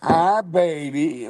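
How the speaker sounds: slow, high parrot croon. Retold or imitated. Retelling the sister-in-law's parrot, high slow croon. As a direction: coo it like a pet parrot. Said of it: "And he goes".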